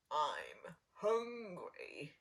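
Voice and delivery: very slow, drawn-out wolf voice; hungry wolf. Acted. Wolf arriving at grandmother's cottage, slow hungry drawl. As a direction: stretch it out, greedy and low. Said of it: "thought the big bad wolf".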